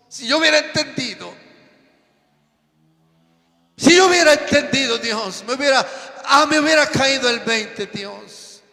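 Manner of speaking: clamando a Dios, de golpe mucho más alto y fuerte; lamento desesperado. Retold or imitated. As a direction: suplica a gritos por los años perdidos. Anciano arrepentido clama a Dios. (lamento a gritos)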